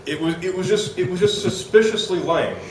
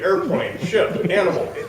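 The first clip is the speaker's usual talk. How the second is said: usual speaking voice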